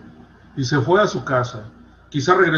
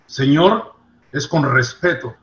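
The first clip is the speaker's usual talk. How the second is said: ordinary delivery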